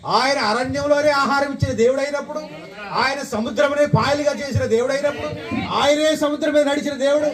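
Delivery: high sermon climax with stretched key phrases; rousing preaching. Real, not acted. Preacher assuring the congregation sickness must go, rousing preaching. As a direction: build conviction, rising to a high ringing declaration.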